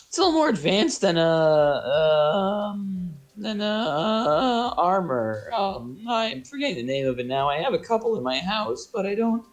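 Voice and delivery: squeaky creature groping for a word; high, hesitant gnome. Acted. Gnome forgets the name of it, high and faltering. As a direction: stall and stumble in a high little voice. Game master voices gnome.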